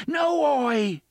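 high, loud desperate scream. A narrator's reading. Jake screaming at Oy on the tracks, desperate scream. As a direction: scream to stop him leaping. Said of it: "Jake screamed".

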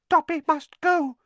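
high, frightened elf voice; squeaky, fearful hurry to flee; Dobby terrified. A narrator's reading. Elf hears footsteps outside and must flee, terrified. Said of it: "breathed the elf, terrified".

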